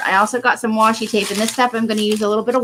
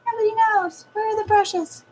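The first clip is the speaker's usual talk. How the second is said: much higher silly squeaky voice; 11 semitones higher, 3 dB quieter than usual. Acted. High put-on voice answering.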